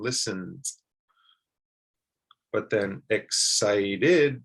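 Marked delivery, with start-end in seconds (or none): 0.0-0.8 "listens" in two syllables, slight end sound; "listens" with no extra syllable on the end; slight final sound. Shown as a demonstration. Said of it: "it's just a slight sound". 3.1-4.4 "excited" drawn out as EX-CI-DID; "excited" with its ED as a full "id"; three distinct syllables. Shown as a demonstration. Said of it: "that's three syllables".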